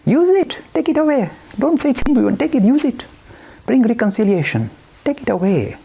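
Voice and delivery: high, urgent urging; insistent offer. Retold or imitated. Offering Hinduism freely to others, in a high urgent voice. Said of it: "We are saying".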